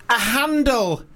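imitated child's high-pitched punchline; a little girl delighted with her own joke; four-year-old girl. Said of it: "she's about four or something".

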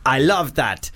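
a hint of a panto Arabian accent. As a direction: read the email in a mock Arabian accent. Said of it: "That was, uh, an Arabian accent".

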